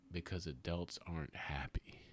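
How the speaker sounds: dropped almost to a whisper; hushed. Retold or imitated. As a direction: let it fall to a whisper, heavy. Daughter's words, dropped voice.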